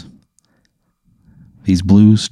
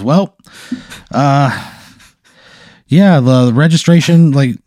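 raised, louder take-charge voice; high, projecting boss voice; booming problem-solver; 5 semitones higher, 3 dB louder than usual. Acted. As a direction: address the customer like the big man in charge. Same figure back to business.

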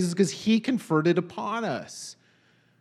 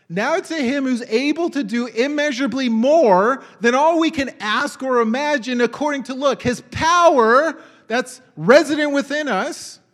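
louder and higher, ringing out the verse; preached scripture; 5 semitones higher, 5 dB louder than usual. Acted. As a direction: proclaim it to the congregation. Preaching verse at full force.

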